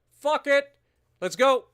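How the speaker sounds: high, loud battle cry; excited yell. Real, not acted. Streamer declaring war on Japan, yelling excitedly. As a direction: shout it, all fired up.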